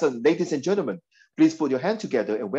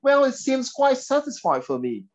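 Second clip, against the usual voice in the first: put-on British accent; 10 semitones higher than usual. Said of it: "only the British people will talk like that".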